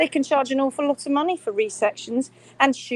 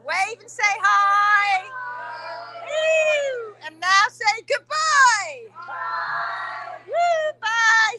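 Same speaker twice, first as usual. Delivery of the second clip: slow, high, loud cheer-leading shout; high shouted cheer leading the group goodbye; 11 semitones higher, 6 dB louder, much slower than usual. Real, not acted. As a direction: whip up the crowd to yell.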